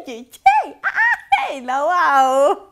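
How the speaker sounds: slow, amused hey hey wow; laughing exclamation. Real, not acted. Amused by the report, laughing out a slow wow. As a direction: laugh it out in disbelief.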